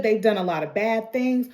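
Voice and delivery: ordinary talk, nothing marked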